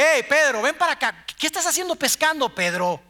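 a scolding yell to a fisherman; mock shouting from the shore. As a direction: call him like an impatient shout from the shore. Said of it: "en la orilla gritándote".

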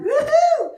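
high, loud falsetto woo-hoo; gleeful whoop. Retold or imitated. Babushka rototilling her garden, gleeful high-pitched whoop. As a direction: whoop in a high old-lady voice. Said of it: "she's rototilling her garden".

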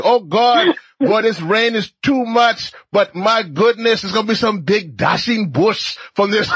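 high, slow, hammed-up Trinidadian lilt; bad Trinidadian West Indian accent. Host playing flood-hit islanders for laughs, broad Trinidadian accent. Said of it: "Trinidadian West Indian accent".

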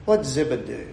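usual speaking voice

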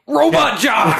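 high announcer yell; excited shout. Acted. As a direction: shout the title like a monster truck ad. Hyping the movie title.

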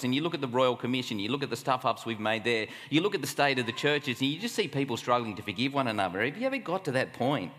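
ordinary talk

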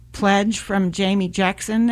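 ordinary talk

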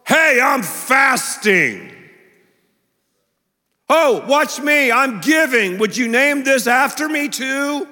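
loud, slow, boastful mock bragging; showy hypocrite. Pastor mocking hypocrites who pray for show, loud boastful bragging. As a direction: brag like a show-off wanting credit.

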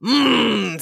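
imitated bovine hum; bull moo. A narrator's reading. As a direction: moo through closed lips. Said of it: "said the bull".

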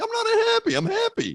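high squeaky protest. Retold or imitated. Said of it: "I'm like".

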